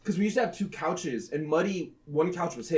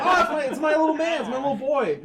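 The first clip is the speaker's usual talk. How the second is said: put-on higher, loud baby talk to a pet; cooing; 6 semitones higher, 4 dB louder than usual. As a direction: coo it to your little cat. Cooing at the cat.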